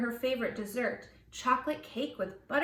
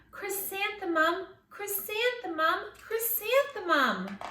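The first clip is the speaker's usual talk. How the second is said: higher, lilting call of the name; happy echo; 7 semitones higher than usual. A narrator's reading. Flowers singing her name back to the happy girl, lilting and high. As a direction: let the name bloom joyfully.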